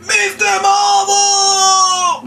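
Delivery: put-on showing how the birthday boy should shout it; big slow shout. Shown as a demonstration. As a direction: bellow the name slowly like a big kid. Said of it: "Put it down to him".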